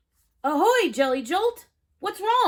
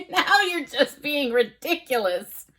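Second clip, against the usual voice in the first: ordinary delivery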